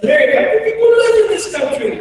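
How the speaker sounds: put-on high and loud, a frozen kid's panicked question; panicked plea. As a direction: beg the flight attendant for an answer in alarm. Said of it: "I looked at Mary and said".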